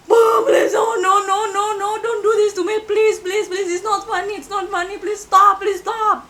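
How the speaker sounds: high, loud panicked sobbing plea; crying scream. Retold or imitated. Terrified girl begging the unseen voice to stop, screaming in tears. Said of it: "Aku ngomong kayak gitu, like, sambil nangis".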